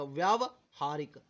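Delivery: व्यावहारिक said very slowly, each syllable clear; slow correct pronunciation. Shown as a demonstration. Teacher correcting students, slowly saying व्यावहारिक. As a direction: draw out व्यावहारिक, not वैव. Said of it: "अब वैव नहीं करना है".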